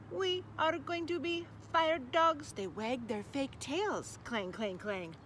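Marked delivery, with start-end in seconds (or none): 0.1-2.5 slow, higher robotic proclamation; robot dog voice. Acted. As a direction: announce it like a proud toy robot. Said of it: "said Torpo".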